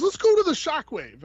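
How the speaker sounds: high Barbarino-style wise-guy voice; artist's voice. Retold or imitated. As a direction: ask it like a Brooklyn tough guy. Voicing the artist.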